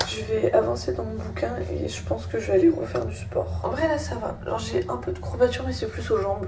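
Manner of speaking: ordinary talk, nothing marked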